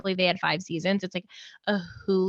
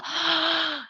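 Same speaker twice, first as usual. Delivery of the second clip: sharp intake of excited realisation; delighted gasp; 7 semitones higher than usual. Real, not acted. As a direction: gasp as the perfect suggestion lands. Thrilled by co-host's show suggestion.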